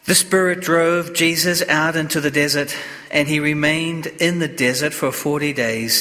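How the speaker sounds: solemn Gospel proclamation in a reverberant church; cathedral echo. Real, not acted. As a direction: read it like a priest at Mass, unhurried. Priest proclaims the Gospel at Mass.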